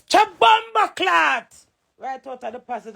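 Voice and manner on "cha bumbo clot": high, slow swearing outburst, imitating him; mock angry curse. Imitating her husband storming out cursing, high and slow. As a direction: spit out his curse in a raised, imitating voice. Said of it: "him says".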